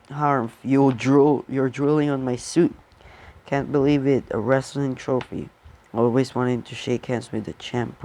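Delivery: usual speaking voice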